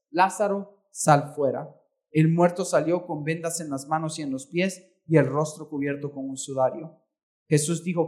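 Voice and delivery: measured, solemn Bible reading aloud; reading scripture aloud to the church. Acted. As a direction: read the passage to the congregation with gravity. Reads Lazarus passage aloud.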